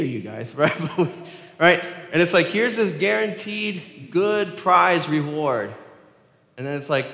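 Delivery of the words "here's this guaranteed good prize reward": game show pitch. Retold or imitated.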